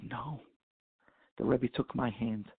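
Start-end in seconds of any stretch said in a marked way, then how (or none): 0.0-0.5 soft echo. Retold or imitated. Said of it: "And the Rebbe said".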